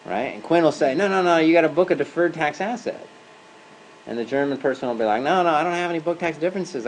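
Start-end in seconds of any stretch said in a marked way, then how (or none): 0.9-3.1 higher-pitched mock insistence; acted insistence. US colleague correcting German controller on a call, insistent. 5.2-6.9 higher-pitched mock protest; acted protest. German controller objecting to US colleague, mock protesting.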